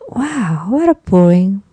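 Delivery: ordinary talk, nothing marked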